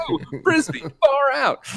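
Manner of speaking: high, loud, laughing goofy Marty line; goofy Marty quote. Retold or imitated. Laughing high goofy teen voice, Marty to the McFly family. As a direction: squeak it out with a laugh, clueless kid.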